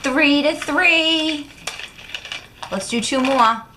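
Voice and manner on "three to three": slow, high, loud score announcement; drawn-out score call. Real, not acted. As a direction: stretch out the tie score like an announcer.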